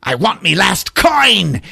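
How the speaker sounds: high, slow leprechaun demand. Acted. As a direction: draw it out high as a greedy leprechaun. Leprechaun demands his coin.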